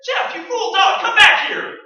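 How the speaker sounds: high, loud calls after the runaway dog; frantic loud shouting. Acted. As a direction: yell for the dog to come back. Dog runs off.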